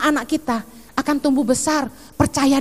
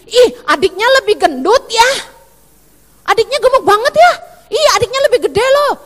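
higher, louder nosy auntie; fast, loud gossipy jab; mocking gossip; 5 semitones higher, 4 dB louder than usual. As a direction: sneer at the chubby twin. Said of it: "langsung gini".

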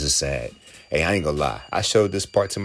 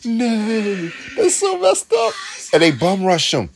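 high, excited disbelief; excited outrage; 11 semitones higher than usual. Real, not acted. Viewer shocked and delighted by the cruelty, high excited outrage. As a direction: pitch it up high, half laughing in outrage.

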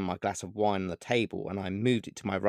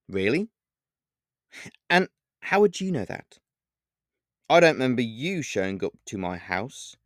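sarcastically; 5 semitones higher, 3 dB louder than usual. A narrator's reading. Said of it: "I replied sarcastically".

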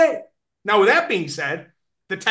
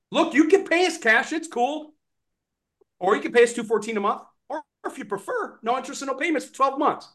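higher, quick rep laying out options; sales pitch demo; 6 semitones higher than usual. Shown as a demonstration. Trainer imagining the rep offering payment options. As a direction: breeze through the offers like a pro. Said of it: "Imagine your sales presentation now".

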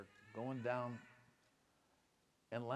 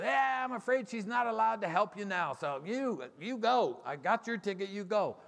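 a hint of an old man, louder and higher; 8 semitones higher, 7 dB louder than usual. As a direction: voice the gatekeeper, a bit raised. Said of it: "the old man turns around and goes".

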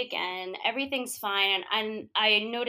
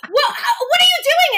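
high, loud exasperated scolding; scolding the kids; 13 semitones higher, 10 dB louder, faster than usual. Retold or imitated. Aunt scolding kids who ran off, loud and high. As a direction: tear into them, loud and high.

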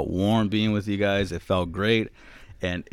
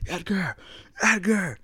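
calling out a name; 11 semitones higher, 3 dB quieter, slower than usual. Retold or imitated. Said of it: "next thing I heard".

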